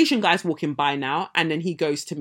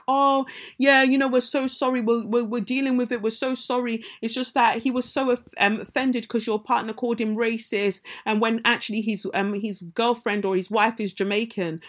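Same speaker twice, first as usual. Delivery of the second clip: mock apologetic manager; 4 semitones higher than usual. Floor manager's apology, mocked.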